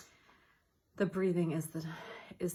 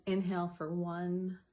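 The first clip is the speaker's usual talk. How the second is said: softer and softer counting, pause held; counted breathing; 3 dB quieter, slower than usual. Shown as a demonstration. Guiding listeners through timed breathing, counting softly down. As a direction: guide the breath count gently, fading. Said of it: "So it's an in".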